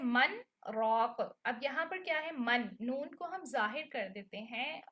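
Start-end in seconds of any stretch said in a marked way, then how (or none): 0.0-1.4 slow मन, रॉक with the noon said fully, no merge; clear noon before ra. Shown as a demonstration. Teacher demonstrating the exceptional case slowly for students. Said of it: "इसका भी एक exceptional case है".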